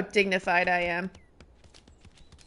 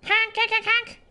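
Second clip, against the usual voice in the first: high squeaky honking; goose honks; 12 semitones higher than usual. Pretend. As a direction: honk like a silly goose. Imitating goose honk, high.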